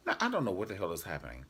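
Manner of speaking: ordinary talk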